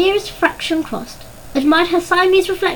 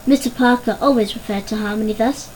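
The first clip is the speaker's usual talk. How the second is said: plain reading voice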